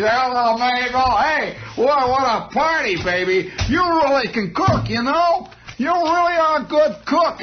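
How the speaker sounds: mock-drunk husband, hammed up and high; tipsy husband. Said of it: "cleaning up the dishes".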